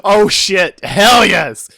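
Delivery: loud, high-pitched hype; thrilled outburst. Real, not acted. Listener hears the story is getting to the meat, whooping loudly. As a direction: shout it with real excitement. Thrilled at what comes next.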